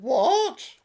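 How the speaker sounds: very high, loud and laughing; comic high yelp; exasperated dad. Acted. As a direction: squeak it out in mock irritation. Father answers daughter, comic.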